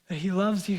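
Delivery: ordinary talk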